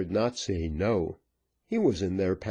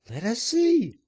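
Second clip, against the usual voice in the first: high woman voice; 4 dB louder than usual. Acted. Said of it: "said one of the party".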